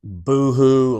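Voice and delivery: loud, hammed-up boo-hoo; mock crying. Shown as a demonstration. Showing how hard the crying was, mock crying. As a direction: wail it out as a demonstration. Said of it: "I don't mean crying".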